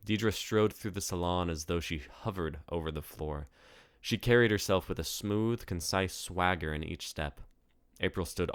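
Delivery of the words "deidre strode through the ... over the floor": ordinary delivery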